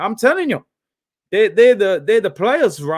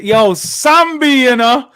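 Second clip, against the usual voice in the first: louder and higher, stretched slowly; drawn-out call; 5 semitones higher, 6 dB louder, slower than usual. Real, not acted. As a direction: call his name out long and loud, in delight. Calling the player's name, drawn out.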